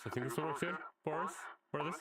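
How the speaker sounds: tinny vintage radio filter; old-timey radio voice. Shown as a demonstration. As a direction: sound like an old broadcast. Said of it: "Like an old-timey radio voice".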